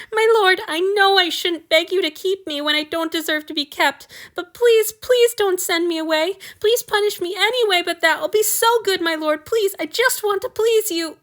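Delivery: high, loud, desperate pleading through tears; tearful begging. Acted. Weeping boy begs his lord to keep him, high and desperate. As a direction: beg him with everything you have. Sacrifice begs not to be sent away.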